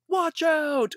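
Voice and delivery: high-pitched mock callout; avatar's yell. Imitating an online avatar yelling, mock shout. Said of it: "yell things".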